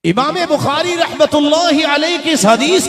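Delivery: high, loud, ringing sermon cry; impassioned high-pitched preacher; full-force preaching. Real, not acted. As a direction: proclaim it to the whole congregation at the top of your voice.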